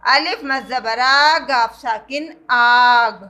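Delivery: آ and آگ said slowly and stretched; long aa. Shown as a demonstration. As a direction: spell آگ out slowly. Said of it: "الف کو ہم کھینچ کر پڑھتے ہیں".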